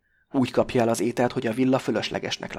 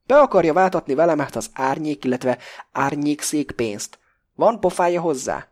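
outraged; 4 semitones higher, 6 dB louder than usual. A narrator's reading. Pakó outraged at Krestopulosz.

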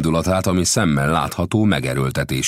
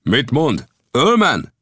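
startled cry, higher and louder; 12 semitones higher, 6 dB louder, slower than usual. A narrator's reading. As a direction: burst out at the name. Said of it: "kiáltott fel most Lang".

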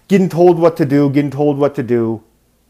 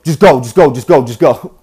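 urgent urging; 4 dB louder than usual. Retold or imitated. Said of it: "one of the passengers goes".

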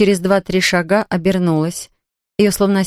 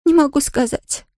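groaning; 9 semitones higher than usual. A narrator's reading. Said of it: "простонала Нэнси".

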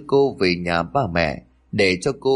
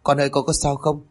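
fake worry; 6 semitones higher than usual. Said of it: "giả vờ lo lắng chạy lại".

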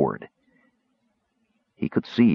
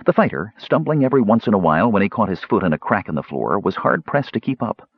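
usual speaking voice

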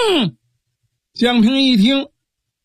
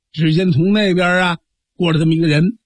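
plain reading voice

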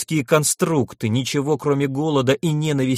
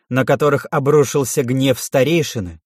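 ordinary delivery

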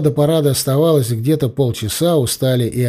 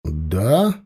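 low, restrained yes; courteous but reserved confirmation; polite reply; 6 semitones lower than usual. A narrator's reading. Narrator confirms to the blonde, still polite. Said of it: "всё так же вежливо кивнул я".

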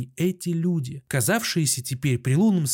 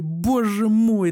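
drawn-out wail; 7 semitones higher, 4 dB louder, much slower than usual. A narrator's reading. Doctor in the ward breaks toward a slow, higher wail. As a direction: repeat it higher, stretched slow and louder. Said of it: "таким тоном, как будто хотел заплакать".

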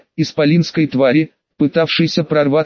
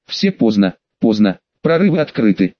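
usual speaking voice

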